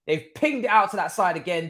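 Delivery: usual speaking voice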